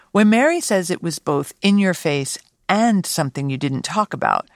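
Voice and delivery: normal talking voice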